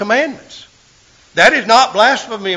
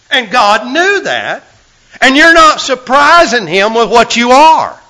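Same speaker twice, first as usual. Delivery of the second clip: high, loud fervent preaching; preaching at full force; 6 semitones higher, 5 dB louder than usual. Real, not acted. Preacher to congregation about sin, in loud fervent preaching. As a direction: drive the point home to the pews.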